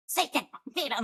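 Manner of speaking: mock muttered Danish curses; lightly voiced husband cursing; imitating cursing. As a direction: curse under your breath like a grumpy husband. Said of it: "He's like".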